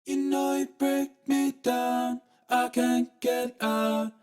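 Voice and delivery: ordinary delivery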